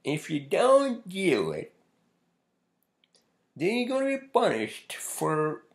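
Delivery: loud, high, slow attempt at a Southern accent; badly faked Southern drawl, pitched high and drawn out; Doctor Phil. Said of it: "I can't do the fucking Southern accent".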